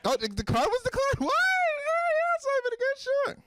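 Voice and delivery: high mock surprise, rising to a squeal; flustered shopper. Shopper told the card was declined, squealing fake surprise. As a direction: overplay innocence, climbing very high.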